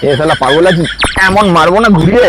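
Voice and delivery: loud threatening yell; angry shout. Acted. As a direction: threaten him loudly.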